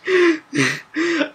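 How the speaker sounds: high-pitched burst of laughter; high, rapid cackling. Acted. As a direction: cackle in a long high run. Mock laugh in acted standoff.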